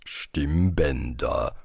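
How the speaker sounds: low, very slow tree voice; einwerfender Baum. A narrator's reading. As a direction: chime in deep and drawn out. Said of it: "warf der Baum ein". (tiefe, gedehnte Baumstimme)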